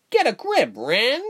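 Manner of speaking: very high-pitched character voice; squeaky-high telling-off; scolding friend. A narrator's reading. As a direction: pull him up in a high voice. Fox scolds Ren, character voice.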